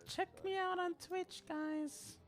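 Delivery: high but soft streamer plug. Retold or imitated. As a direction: lightly mimic a streamer, quietly. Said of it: "I would be like".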